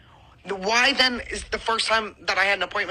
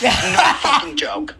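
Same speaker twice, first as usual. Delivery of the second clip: louder and higher, slow and hard; angry outburst; 8 semitones higher, 7 dB louder, slower than usual. Real, not acted. Ranting to friends about the clinic, furious and loud. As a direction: spit out each word in real fury.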